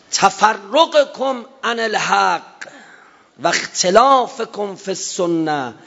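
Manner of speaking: high, slow fervent sermon; full-force preacher's cry; impassioned preaching. Acted. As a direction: raise the voice and draw it out. Preaching at peak.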